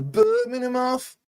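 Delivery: drawn-out Brummie place name; Brummie accent. Acted. Commentator teasing the chat with the opposing team's name, mock Brummie accent. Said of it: "trying to do a Brummie accent".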